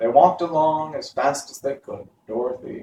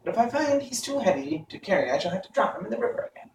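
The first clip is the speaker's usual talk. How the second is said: higher bird voice; a sharp-eyed stork, pitched up; 8 semitones higher, faster than usual. A narrator's reading. Stork correcting the travellers, higher voice. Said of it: "said the stork".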